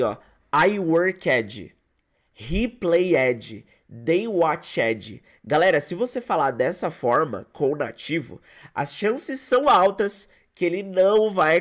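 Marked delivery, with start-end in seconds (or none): 0.5-5.2 "worked", "played", "watched" each ending in a full extra syllable "-edji"; wrong "ed" pronunciation. Teacher imitating learners who mispronounce the past tense, extra "edji" syllable. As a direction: sound out every "ed" as "ed-ji", the way learners get it wrong. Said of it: "e ela fala assim".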